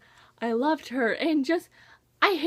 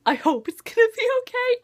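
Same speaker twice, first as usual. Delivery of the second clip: anxious hope; 10 semitones higher, 4 dB louder, faster than usual. Real, not acted. Hopeful reassurance then doubt.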